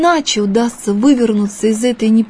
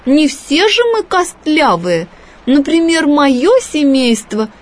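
huffy, a little higher; offended fish; 6 semitones higher, 3 dB louder than usual. A narrator's reading. The fish to the boy, lightly huffy and higher. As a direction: mildly put out, defending her family. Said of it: "обиженно вскричала рыба".

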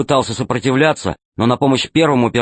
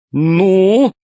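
high, slow jolly villain; fat pirate voice; 8 semitones higher, 4 dB louder than usual. Acted. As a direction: play the grinning pirate boss. Tolstyak demands.

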